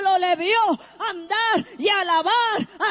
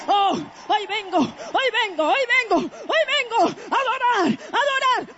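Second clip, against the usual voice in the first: building worship cry; 3 semitones higher than usual. Retold or imitated.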